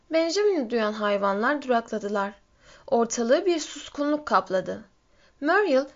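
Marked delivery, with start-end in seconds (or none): none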